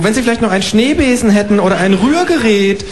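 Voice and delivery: high, chirpy kangaroo asking for eggs. Acted. Kangaroo asking the neighbour for eggs, high and chirpy. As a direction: pipe up like a polite cheeky kangaroo.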